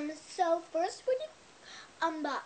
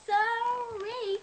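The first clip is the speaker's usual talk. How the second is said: high sorry; 9 semitones higher, 3 dB louder than usual. Real, not acted. Apologizing after a loud yes.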